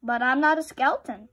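loud, fast protest. A narrator's reading. Boy insisting to the skeletons he is not one, loud and fast. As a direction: push back quickly and loudly.

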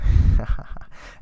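loud short laugh. Real, not acted. Astrologer laughing at the jealous girlfriend scenario, loud laugh.

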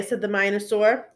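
ordinary delivery

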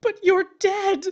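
high, loud insistence; disbelieving protest. Acted. Widow protesting to her dead husband, loud. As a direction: cry out in disbelief.

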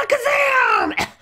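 high shouted magic word. Pretend. Tails casts the rabbit spell with a shout.

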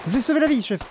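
proud soldier boast. Pretend. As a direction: brag like a cocky private to his officer. Repeats boast higher.

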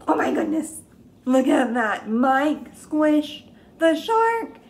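high, loud, slow amazed exclaim; hammed-up storytime amazement; excited reveal. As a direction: wow the kids with the twist.

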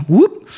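high, loud rising whoop; whoop sound effect. Retold or imitated. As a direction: pop out a playful rising "whoop". Imitating a quick motion noise.

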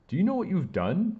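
loud, high angry outburst; shouting. A narrator's reading. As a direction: shout it at the child, pitch way up. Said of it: "Mister Banner shouted".

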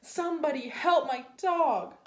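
high, loud cry for help; yelled plea. Acted. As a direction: call out for help, storybook style. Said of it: "yelled Mark".

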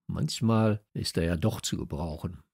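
ordinary delivery, nothing marked

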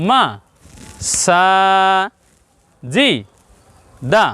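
মা short, সা long, জি and দা short; short and long syllables. Shown as a demonstration. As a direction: spell out মা, সা, জি, দা one by one, stretching only সা. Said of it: "এখানে সংক্ষেপ হবে".

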